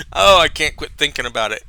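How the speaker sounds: usual speaking voice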